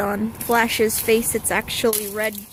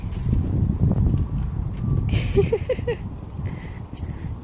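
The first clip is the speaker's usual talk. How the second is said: airy laugh; 10 semitones higher than usual. Real, not acted. Owner laughing at dogs.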